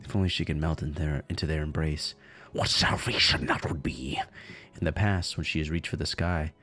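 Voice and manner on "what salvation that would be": breathy dramatic whisper, slow; slow, hushed narration. Acted. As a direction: hush it like a secret longing. Dramatic line read as whisper.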